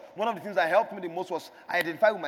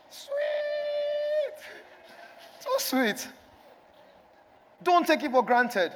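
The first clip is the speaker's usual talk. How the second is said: rising, louder sermon build; preaching; 2 semitones higher, 5 dB louder than usual. Acted. Pastor exhorting the church to build and pour, preaching. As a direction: preach it out to the congregation.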